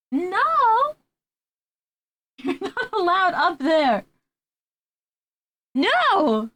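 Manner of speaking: high laughing scold; half-laughing reprimand to the cat on the shelf. Real, not acted. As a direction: shout no at the cat.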